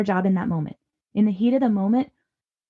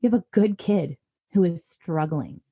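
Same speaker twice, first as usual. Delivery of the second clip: ordinary talk, nothing marked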